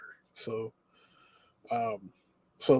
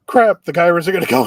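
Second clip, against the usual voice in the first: hurried, higher, louder mild panic; mock freak-out; 4 semitones higher, 8 dB louder, faster than usual. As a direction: imitate a room starting to panic. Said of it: "kind of freaking out".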